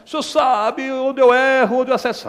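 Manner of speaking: imitating a crying believer; mock whiny prayer. Whining believer again. (oração chorosa imitada)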